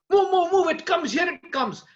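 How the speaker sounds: loud, high urgent warning; urgent shout. Acted. Marksman warning his friend of the falling arrow, urgent shout. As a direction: yell at them to move.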